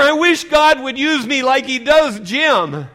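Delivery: high, loud, drawn-out envy; acted loud envious lament; loud complaint. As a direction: whine it slowly at full voice. Voicing a believer's loud complaint.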